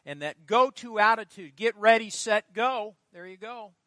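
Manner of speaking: loud, high, ringing sermon cry; preaching at full force. Acted. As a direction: proclaim it to the congregation. Preacher builds to exhortation.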